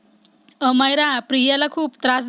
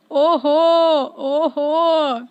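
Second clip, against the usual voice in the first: long drawn-out teasing exclamation; 3 dB louder, much slower than usual. A narrator's reading. Priya catches the two hugging on the gallery, teasing. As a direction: stretch it out as playful mock surprise.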